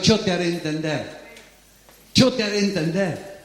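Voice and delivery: gentle divine reassurance; voice of God. Acted. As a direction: answer as God, steady and sure. Pero dice Dios.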